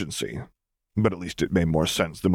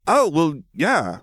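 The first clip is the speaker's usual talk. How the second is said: put-on a touch higher, offhand girl; lightly voiced Lily; 9 semitones higher, 3 dB louder, much slower than usual. Lily admitting it offhandedly, lightly raised voice. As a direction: shrug it off lightly.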